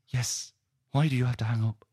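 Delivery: put-on high-pitched girlfriend voice; insistent Chloe. Girlfriend pressing him for an answer on the phone, high and insistent. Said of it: "And she goes".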